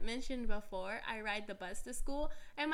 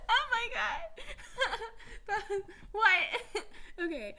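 high and loud through laughter; laughing; 12 semitones higher, 4 dB louder than usual. Real, not acted. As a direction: talk while still cracking up. Laughing after failed ASMR.

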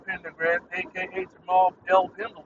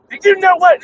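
climbs higher and louder each time; building rhetorical shout; 8 semitones higher, 12 dB louder than usual. Real, not acted. Podcaster venting at his app, building to a shout. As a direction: ramp it up into a shout.

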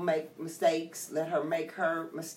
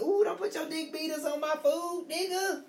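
mock scolding; 9 semitones higher than usual. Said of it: "I be like".

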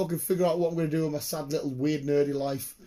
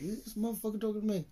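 menacing coworker; 7 semitones higher, 6 dB quieter, faster than usual. Retold or imitated. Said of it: "they're just like".

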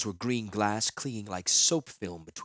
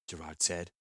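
usual speaking voice

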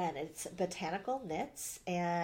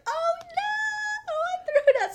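much higher, louder, hammed-up squeal of dismay; mock panicked wail; 11 dB louder, slower than usual. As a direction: replay the moment you realised it was gone. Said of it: "last night I was like".

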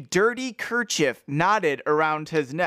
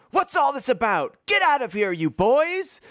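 raised-pitch, hammed-up wrathful scolding; wrathful shout; 6 semitones higher than usual. Man telling boys to get out, mock wrathful shout. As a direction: order them out in mock wrath. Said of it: "shouted, uh, the man wrathfully".

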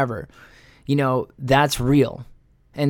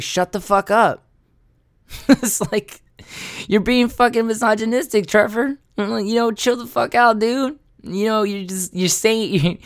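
worked-up rant; 6 semitones higher than usual. Real, not acted. Telling Trevor off in a high exasperated rant. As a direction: throw it up high in disbelief.

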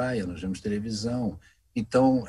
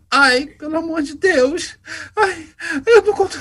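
overwhelmed fan; 9 dB louder than usual. Retold or imitated. Young box office clerk recognizing the voice actor, squealing excitedly. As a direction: shriek it out like you can't believe who's in front of you. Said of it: "ela ficou muito emocionada".

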